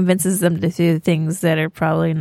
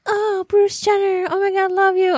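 gushing fans; 11 semitones higher than usual. Retold or imitated. Fan women swooning over Bruce Jenner at a dinner, high gushing.

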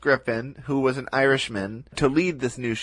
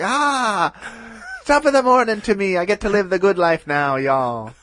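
hammed-up Irish brogue, higher and louder; Irish accent; 6 semitones higher, 3 dB louder than usual. Irishman Charles gloating over his farm, in a hammed-up Irish accent. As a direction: play the cheerful Irish sheep farmer in a broad Irish accent. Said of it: "Irish is, like, one of the three accents I sort of can do".

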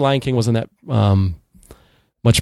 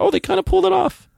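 surprised. Retold or imitated. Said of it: "I was like".

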